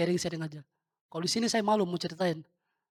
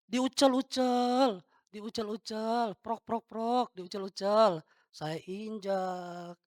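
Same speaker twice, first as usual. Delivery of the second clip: slow, elongated running commentary on a demonstration; drawn-out action narration; 3 semitones higher, much slower than usual. Shown as a demonstration. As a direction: stretch each word along with the crumpling. Memeragakan uang diremas dan diinjak. (mengucapkan tiap aksi dengan suara dipanjangkan)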